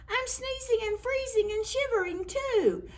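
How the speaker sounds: high, strained shivering snowman voice. A narrator's reading. The snowman tells the children he is freezing, high.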